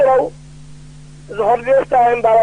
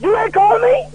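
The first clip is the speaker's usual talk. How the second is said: mock surprised reproach; 6 semitones higher, slower than usual. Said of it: "I said".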